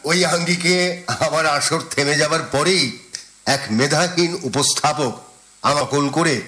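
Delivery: normal talking voice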